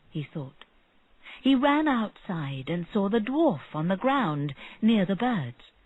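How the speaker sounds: plain reading voice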